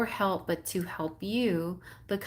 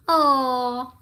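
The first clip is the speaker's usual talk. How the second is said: delighted gasp; 9 semitones higher, 7 dB louder than usual. Real, not acted. Delighted reaction to card message.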